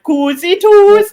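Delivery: "Cozy toes" drawn out slow and high; mock local accent. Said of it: "and you are like".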